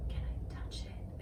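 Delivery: slow, tentative whisper; sensitive, quiet. Retold or imitated. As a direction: imitate a tender, hushed request. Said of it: "being all sensitive and quiet".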